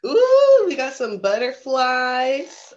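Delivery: high, loud, slow delighted coo; thrilled gasp of delight, high and loud; delighted ooh. Real, not acted. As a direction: gush over the picture. Host delighted by book page.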